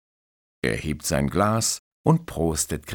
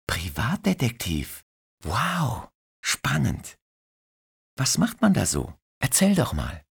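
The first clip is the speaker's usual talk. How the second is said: put-on curious young woman; 8 semitones higher than usual. Christine, curious and lively.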